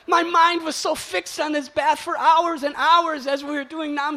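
loud high impassioned plea. Retold or imitated. Stung bather justifying staying, loud high plea. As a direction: plead that you won't give it up.

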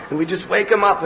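usual speaking voice

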